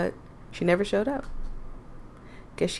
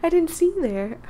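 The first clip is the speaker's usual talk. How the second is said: Kat startled; 7 semitones higher than usual. Acted. Voicing Kat's startled line.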